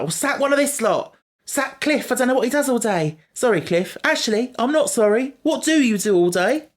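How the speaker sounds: high, loud ranting voice; shrill indignant rant at the boss; Donna ranting. Retold or imitated. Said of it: "she's like".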